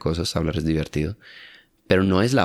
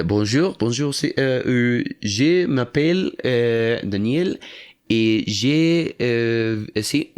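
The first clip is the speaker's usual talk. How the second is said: halting, higher mock beginner stumbling through French; struggling French learner; 4 semitones higher, much slower than usual. Imitating a learner frozen in front of a French speaker, halting. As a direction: play a flustered learner groping for words. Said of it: "me encuentro con un francés e intento".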